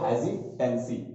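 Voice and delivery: हेजिटेंसी said slowly as hezitensi; slow model pronunciation. Shown as a demonstration. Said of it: "pronunciation देख लो". (हेजिटेंसी धीरे से साफ़ उच्चारण)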